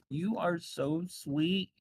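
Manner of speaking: put-on doting old lady, slow and sweet; tearful, doting. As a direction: coo it through held-back tears.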